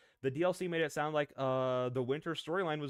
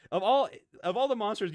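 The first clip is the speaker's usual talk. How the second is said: emphatic outburst; 9 semitones higher, 8 dB louder than usual. Real, not acted. Arguing her point.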